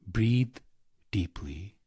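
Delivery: usual speaking voice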